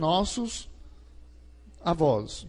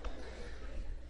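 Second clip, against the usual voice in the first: stifled mock sob; 16 dB quieter than usual. Husband's hidden cry, mocked.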